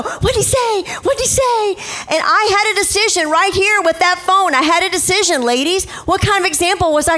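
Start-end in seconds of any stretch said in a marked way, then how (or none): 0.0-1.7 put-on high-pitched pestering child voice; high, insistent kid questioning; eager kids. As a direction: imitate children clamoring to know. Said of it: "the kids would go".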